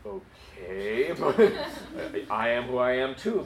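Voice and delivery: drawn-out, rising, doubtful okay; mock puzzled. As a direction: stretch it out as if humoring an oddball. Puzzled reply to weird answer.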